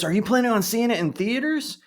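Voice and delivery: high, bright question voice; lightly voiced curious waiter; imitating the waiter. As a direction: ask eagerly like a friendly waiter. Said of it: "And he goes".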